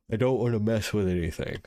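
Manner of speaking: talking through a yawn; words stretched by a yawn. Real, not acted. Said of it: "I'm getting yawny".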